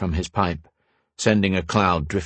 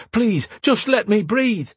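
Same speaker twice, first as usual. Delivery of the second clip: desperate plea; 12 semitones higher, slower than usual. Acted. Drowning memory, begging.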